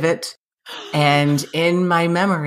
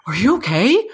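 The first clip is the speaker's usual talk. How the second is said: imitating alarm; 4 semitones higher than usual. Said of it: "the look of terror on his face".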